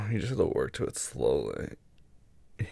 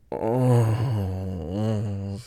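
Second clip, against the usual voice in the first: drawn-out moan of pleasure; long moan. Acted. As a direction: let out one long groan. Overcome by pleasure.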